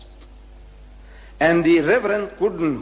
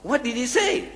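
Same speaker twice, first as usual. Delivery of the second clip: higher, pushy demand to hurry up; impatient prodding; 9 semitones higher than usual. Retold or imitated. Reverend pressing the Maulana for the answer, impatient. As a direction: reverend stamping his feet, impatient. Started stamping his.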